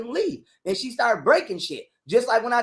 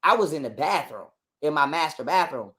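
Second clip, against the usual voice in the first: normal talking voice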